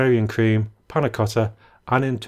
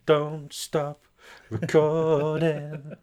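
drawn-out echo; 4 semitones higher, much slower than usual. Pretend. Echoing co-host's parting instruction.

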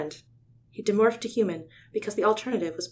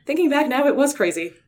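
sheepish admission; 7 semitones higher, 9 dB louder than usual. Acted.

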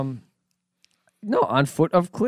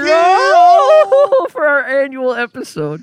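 laughing excitement; 8 dB louder than usual. Real, not acted. Hyped joke, high and loud.

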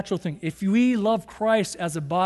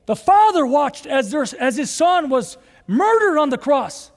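rising, louder preaching of the cross; 7 semitones higher, 6 dB louder than usual. Acted. Preacher proclaiming the gospel to the congregation, fervent and raised. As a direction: build it higher and louder to the resurrection.

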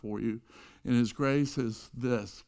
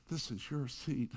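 much softer, almost private; quiet offer; 9 dB quieter than usual. Retold or imitated. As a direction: give the seat away quietly, no show. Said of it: "'cause nobody heard that".

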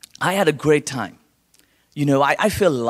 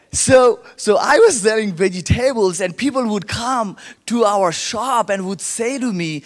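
normal talking voice

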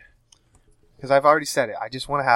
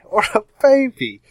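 excited echo; 9 semitones higher, 7 dB louder than usual. Real, not acted. Echoing joke excitedly.

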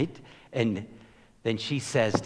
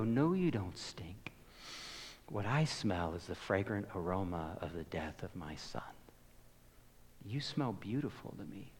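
tender whisper of God; 3 semitones lower, 10 dB quieter than usual. Acted. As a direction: breathe comfort into their ear. God answering the broken sinner.

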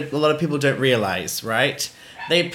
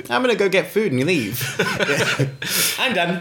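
laughing; 5 semitones higher than usual. Real, not acted. Laughing at own quoted exit line.

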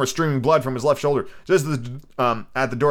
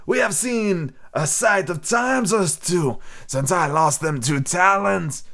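put-on higher, slow old sea dog; sly pirate; 6 semitones higher, much slower than usual. Stranger toasting his mate Bill, slow pirate voice. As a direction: drawl it like a scheming sailor.

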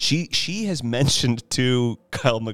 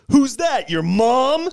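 jock taunt; 8 dB louder, slower than usual. Retold or imitated. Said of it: "He goes".

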